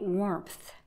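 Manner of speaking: usual speaking voice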